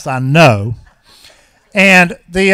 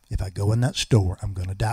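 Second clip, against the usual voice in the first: hushed repeat; 11 semitones lower, 10 dB quieter than usual. Retold or imitated. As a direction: lower and softer, near a murmur. Repeated premonition, quieter.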